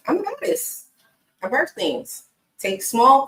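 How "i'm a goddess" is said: "I'm a goddess" repeated pitched up and proud; "I'm a goddess" sung out high. Real, not acted. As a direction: declare it up high like a diva. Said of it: "I told you I'm a goddess".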